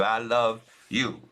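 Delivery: slow, drawn-out declaration of love; deliberate declaration. Real, not acted. Telling viewers in case nobody else has, slow and deliberate. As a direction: stretch it out so it lands.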